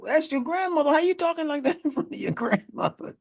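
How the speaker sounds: very high, indignant mock scold; hammed-up disbelief at the cussing; incredulous scolding. Someone scolding a youth cursing before grandma, very high mock voice.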